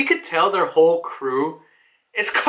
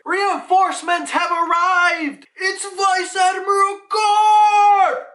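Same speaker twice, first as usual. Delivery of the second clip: triumphant shout; 11 semitones higher, 4 dB louder, slower than usual. Retold or imitated. Marines cheering Garp's arrival.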